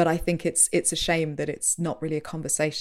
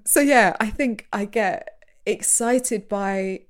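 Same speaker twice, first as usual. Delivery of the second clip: usual speaking voice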